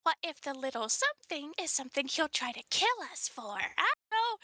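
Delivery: normal talking voice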